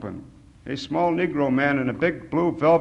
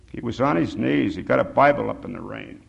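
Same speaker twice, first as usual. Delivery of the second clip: usual speaking voice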